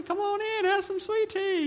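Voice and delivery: put-on high, sing-song Southern accent; imitated Southern drawl, very high; Southern hospitality voice. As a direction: chirp it like a sweet Southern host. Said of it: "everybody's like".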